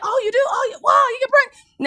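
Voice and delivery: high eager exclamations; excited people. Retold or imitated. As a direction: gush with excitement. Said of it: "They're like".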